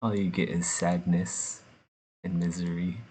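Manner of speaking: ordinary delivery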